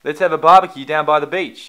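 exasperated show of Australian; Australian accent. Shown as a demonstration. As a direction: correct them with a projected Australian accent.

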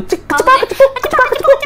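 hammed-up high squeal of hurry up; mock TikTok beggar. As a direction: chirp it like a pleading livestreamer. Mimics a TikTok begging livestream.

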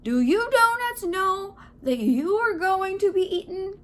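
loud, high call across the bakery; yelling. A narrator's reading. Baker yells to the other donuts, loud. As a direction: holler it to a crowd. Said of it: "The baker yelled".

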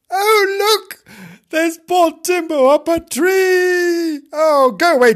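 very high, loud, drawn-out cry; alarmed girl. Acted. Girl spotting poor kitten up a tree, alarmed. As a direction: call out shrilly to everyone in alarm.

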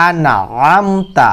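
"আনআমতা" slow, squeezed throat sound before "am"; strong throaty ayn. Shown as a demonstration. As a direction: stretch "আনআমতা" with a hard ayn. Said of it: "শক্ত করে উচ্চারণ করবেন".

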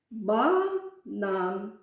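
"Банан" as two separate, drawn-out syllables, "ба-нан". Shown as a demonstration. Teacher counts the syllables of the word, syllable by syllable. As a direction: pause between the halves of "Банан". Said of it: "Я вимовила два рази".

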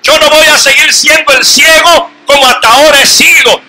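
loud, high, desperate blind man pleading to be heard; blind beggar crying out. Acted. Blind man crying out for Jesus, loud desperate shouting. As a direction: shout it out like a blind beggar who won't be silenced.

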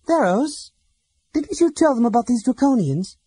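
high, loud, urgent question; agitated Laurana. A narrator's reading. Laurana presses Theros about the Draconians, high and urgent. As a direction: demand an answer, upset.

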